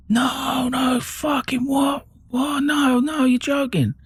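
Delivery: very high-pitched wail of disbelief, imitated; dad's drawn-out, stunned cries of denial; retold shocked cry. Dad hearing the terrible news.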